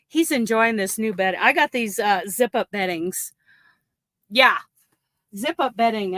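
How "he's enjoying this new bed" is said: ordinary delivery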